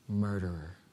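hushed, low accusing voice; quiet, low imitation of a whisper; retold whisper. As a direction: drop low and soft, imitating a whispered message. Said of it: "this voice came to her and whispered to her".

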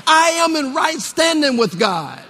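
ringing preacher's conviction; like you mean it. Shown as a demonstration. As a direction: proclaim it boldly to the congregation. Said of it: "Say it like you mean it".

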